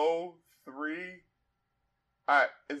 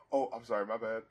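much softer, lower mock apology; 8 semitones lower, 11 dB quieter, faster than usual. Rapper retold cutting in on the singer, soft apology. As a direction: murmur the sorry under the breath. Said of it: "so he just goes like".

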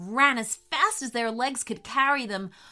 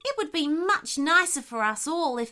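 higher, pleading animal voice; animal character, wistful; 6 semitones higher, 3 dB louder than usual. Acted. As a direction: wish for it earnestly, pitched up. Character speaks in the story.

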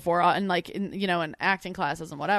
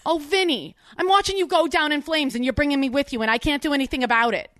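put-on high-pitched, pleading Jersey accent; 8 semitones higher, 4 dB louder than usual. Fiancée watching Vinny fail, distressed Jersey accent. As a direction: complain helplessly in a Jersey accent. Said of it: "I'll do it in a Jersey accent".

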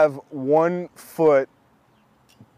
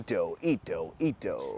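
echo voice; 12 semitones lower, 13 dB quieter, slower than usual. Pretend. Echoing the name in low voice.

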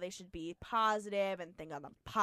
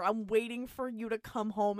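ordinary delivery

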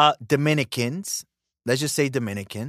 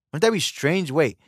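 ordinary talk, nothing marked

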